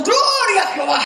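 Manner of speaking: high-pitched mock rallying cry; retold praise shout. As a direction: cry praise back at the attackers. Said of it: "y nosotros gritamos".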